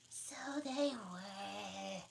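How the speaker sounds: very slow, high, drowsy; Dormouse voice. Acted. Dormouse agreeing, slow and high. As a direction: drawl it half asleep.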